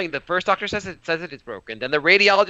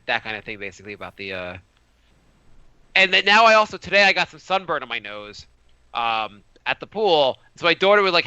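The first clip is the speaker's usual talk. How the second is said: usual speaking voice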